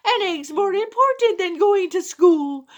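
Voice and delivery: shouting wife worm. Acted. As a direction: screech back at your husband to save the egg. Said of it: "the wife of the worm shouted back".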